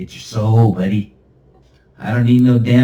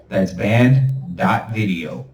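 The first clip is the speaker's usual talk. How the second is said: slow emphatic url; much slower than usual. Shown as a demonstration. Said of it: "repeats url".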